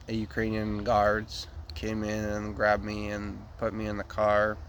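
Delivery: ordinary talk, nothing marked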